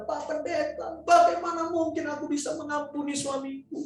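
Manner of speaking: high-pitched, heartbroken acted plea; imitated tearful protest. Crying wife tells pastor she cannot forgive, acted anguished plea. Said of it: "Ibu itu nangis lagi".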